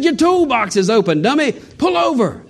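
high, urgent mock call to pull over. Stranger warning the preacher from his car, high mock call. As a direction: pitch it up like a stranger yelling.

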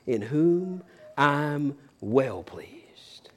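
very slow near-whisper, drawn out; hushed reverence. Acted. Preacher voicing God the Father about his beloved Son, hushed reverence.

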